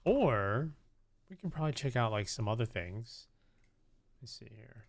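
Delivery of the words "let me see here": soft whispered aside; whisper to self. Real, not acted. Streamer checking his screen, whispering to himself. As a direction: whisper it under your breath while looking.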